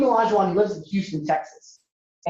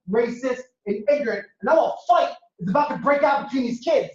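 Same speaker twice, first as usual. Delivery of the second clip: normal talking voice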